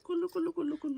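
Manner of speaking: high cooing kullu kullu. Real, not acted. Father cooing his baby's nickname, high and sing-song.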